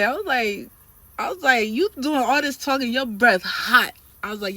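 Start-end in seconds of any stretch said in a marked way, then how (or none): 1.7-3.9 loud, high retort slowing into punched words; loud clapback. Retold or imitated. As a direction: drag out the insult at full voice. Retold insult thrown at the trainer.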